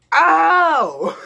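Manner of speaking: very high, loud, long oh; mock epiphany shouted high; exaggerated realization. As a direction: cry out as it all clicks. Repeats the realization, much higher.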